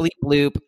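chirpy robotic bleep bloop; mock robot blip; robot noise. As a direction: play a beeping machine shutting off. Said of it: "not responding".

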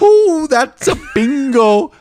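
high, gleeful squeal, drawn out; hammed-up high-pitched movie villain exclamation; Christoph Waltz. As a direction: stretch it out in a very high, delighted voice. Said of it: "This is Christoph Waltz".

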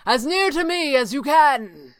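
high, carrying command; called order. A narrator's reading. Stranger gathering the heroes around him, called order. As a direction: call them in close, urgently. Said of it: "the stranger ordered".

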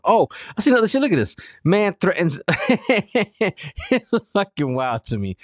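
loud, high burst of disbelief; excited outburst. Real, not acted. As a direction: blurt it out in shocked amusement. Reacting to shocking headline.

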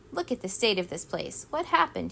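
ordinary delivery, nothing marked

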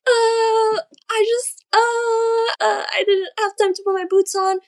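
high, stammering, teary excuse; near tears. Retold or imitated. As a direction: fumble the excuse while close to crying. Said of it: "I'm, like, crying".

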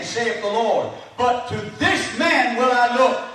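high, drawn-out pulpit proclamation; slow, ringing Bible reading; preached scripture reading. Acted. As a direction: proclaim the verse to the whole church. Reads scripture to congregation.

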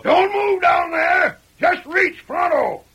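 slow, high shouted order; called out. Acted. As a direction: bark it down the hill. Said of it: "called out".

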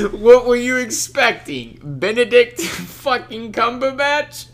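high, slow exasperated yelling; Seth Rogen yelling. Retold or imitated. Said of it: "he's like".

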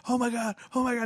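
high, rising panic; describing a flapping bathroom door in the game, panicked. Retold or imitated. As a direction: squeal it like you're getting spooked. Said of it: "And you're like".

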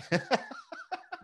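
very high wheezy laugh. Real, not acted. Host reading a joke about killing him, laughing high. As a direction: burst into a high, soft laugh.

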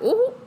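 high squealed mock ooh; a squeaky mock ooh at sass. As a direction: let out a high teasing ooh.

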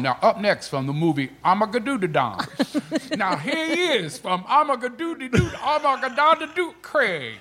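mock a fumbling cue-card read; Magic mangling Armageddon. Comic imitating his co-host reading the cue card. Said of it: "Magic is reading the cue card going".

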